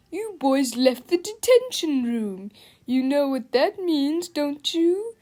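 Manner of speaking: slow, taunting principal voice; gloating principal. A narrator's reading. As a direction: relish catching them out, slowly. Gloating principal catches the boys.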